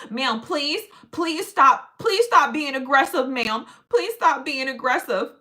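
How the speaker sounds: loud, high, hammed-up alarm; mock pleading critic. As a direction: overact a victim begging for mercy. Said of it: "people that be like".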